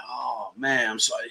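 low, quiet, rueful aside; retold embarrassed mumble, dropped low; sheepish apology. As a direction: say it small and apologetic. Retells sheepish apology to instructor.

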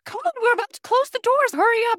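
high, urgent usher hurrying people along; stressed-out usher. Retold or imitated. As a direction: imitate a frantic usher calling out. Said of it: "usher is so stressed out".

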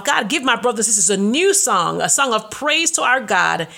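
earnest preaching prayer, swelling on hope; fervent prayer. Acted. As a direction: pray it out with conviction. Praying and preaching aloud.

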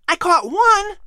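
put-on high, bright young boy's voice; a keen son offering to help; boy asking his mother eagerly; eager boy Patrick.

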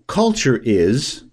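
1 slow halting quote. Retold or imitated. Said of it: "I'll just read what she said".

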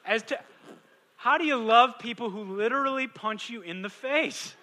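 high, loud, urgent preaching cry; pleading with the congregation at the top of the voice; preaching shout. Real, not acted. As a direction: throw the question out at full force. Preacher builds to rhetorical cry.

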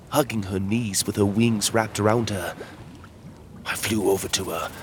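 ordinary delivery

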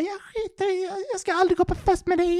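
high falsetto mock of a drunk woman whining; drunk girlfriend. Mimicking the drunk woman in his back seat, shrill falsetto. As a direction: squeak it out like the sulking drunk girlfriend. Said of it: "Hon bara".

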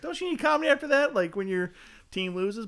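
high, quick mock plea; retold incredulous protest. Comic to bookers who canceled over a lost playoff game, mock incredulous protest. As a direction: start very high and fast, easing down as you reason.

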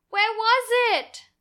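put-on high, loud, slow, desperate little girl; a little girl crying out for her lost sticker; child's frantic cry. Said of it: "I wrote that in all caps and bold".

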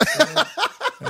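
laughing through the words. Real, not acted. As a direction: break into laughter mid-line. Laughing at the Snickers joke.

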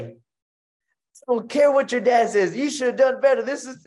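high, loud, slow mock coach scolding; hammed-up angry coach tearing into his son; stern coach voice.

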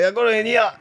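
high, put-on voice of a bloke who can't speak properly; mocking a drugged-up seller's voice; mock dodgy bloke. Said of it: "couldn't actually speak properly".

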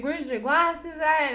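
a hint of the teacher's gentle concern; teacher asking the student about her glasses, worried. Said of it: "She's like".

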